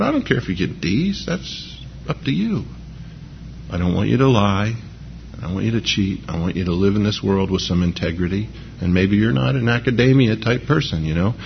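softly comforting a child over a bad grade; gentle reassurance. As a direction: ease the child's worry quietly. Father reassuring child over report card.

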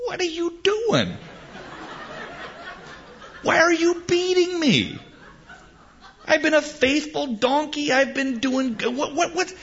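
high-pitched, pleading donkey voice; a wounded donkey begging its master to stop; talking donkey. Acted. Donkey protesting to Balaam over the beating, high and pleading. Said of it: "the donkey says".

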